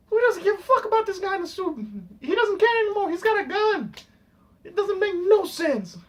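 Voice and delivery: high-pitched, incredulous complaining; exasperated rant. Real, not acted. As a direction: protest nonsense with rising exasperation. Exasperated rant about the film's plot.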